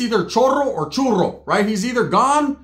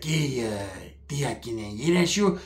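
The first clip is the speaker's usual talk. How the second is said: low, soft mumbly cousin voice; imitated low-key smooth talker; cousin's put-on voice; 6 semitones lower, 8 dB quieter than usual. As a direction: play the cousin asking a favor, low and hushed. Voicing his cousin begging his aunt.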